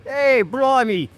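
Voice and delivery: high, drawn-out hammed-up Cockney; pitifully overdone, slow high-pitched Cockney; Cockney accent. Shown as a demonstration. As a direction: pile on the stock Cockney in a high voice. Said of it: "do Cockney".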